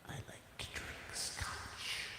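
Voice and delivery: slow breathy whisper. Pretend. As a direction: whisper it slowly, like a secret. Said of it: "you know what I do like".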